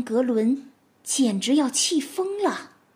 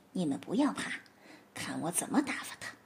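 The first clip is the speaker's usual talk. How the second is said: much softer, quick reassuring aside; whispered aside; 6 semitones lower, 11 dB quieter, faster than usual. Acted. Fox to her family, quiet quick aside. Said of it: "列娜小声地对自己的妻小说".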